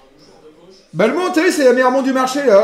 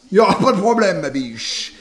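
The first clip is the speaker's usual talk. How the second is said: ordinary delivery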